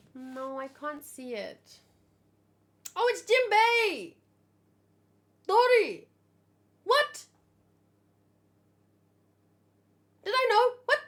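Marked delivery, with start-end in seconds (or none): 3.0-11.0 much higher, louder startled exclamations; shocked surprise. Real, not acted. Streamer reacts to spoiler pictures, shocked and loud. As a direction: gasp at the reveal.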